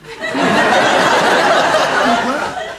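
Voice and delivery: long airy laughter; wheezing laugh; breathy laugh. Real, not acted. Laughing at own story.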